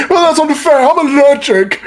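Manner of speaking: very high, loud comic whine; whining victim. Acted. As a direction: squeal a mock complaint in a put-on high voice. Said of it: "He goes like".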